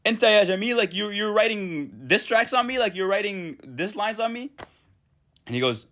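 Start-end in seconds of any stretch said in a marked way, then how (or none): 0.0-4.5 put-on girl confronting Jamil over diss lines, high-pitched indignation; indignant girl. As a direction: play the offended girl calling him out. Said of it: "she goes".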